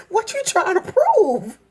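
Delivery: high, slow mocking question; mock elder taunt. Grown folks mocking the girl, high slow teasing. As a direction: drag it out, teasing. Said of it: "It's like".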